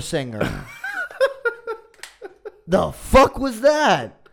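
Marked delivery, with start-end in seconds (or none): 0.7-4.2 high, loud laughing disbelief. Real, not acted. Laughing at the singing.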